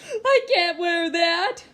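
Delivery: slow, loud, high sobbing wail; crying refusal. Acted. Boy refusing the Leafs sweater, sobbing loudly. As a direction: drag it out through sobs, loud and high. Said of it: "I was crying".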